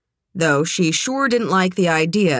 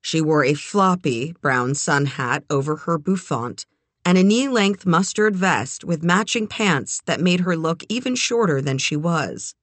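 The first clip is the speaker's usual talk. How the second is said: ordinary delivery, nothing marked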